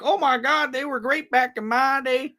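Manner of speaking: high, hammed-up Southern old-timer gushing; fake Southern accent. Mocking old fans in a hammed-up Southern accent. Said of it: "you're welcome to do a fake Southern accent anytime".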